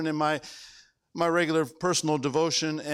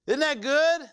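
usual speaking voice

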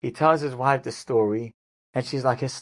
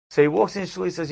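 normal talking voice

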